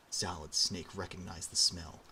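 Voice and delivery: ordinary delivery, nothing marked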